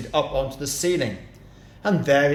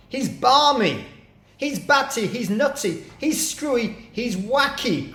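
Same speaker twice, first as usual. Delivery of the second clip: mock alarmed cry; 10 semitones higher than usual. A narrator's reading. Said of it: "cried the roly-poly bird".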